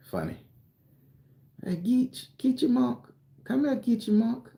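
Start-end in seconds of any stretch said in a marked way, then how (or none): 1.6-4.5 high, sing-song call of a relative; family calling him. Retold or imitated. Family calling little Geechee to come here, high and sing-song. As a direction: holler the nickname up high like kin calling a kid.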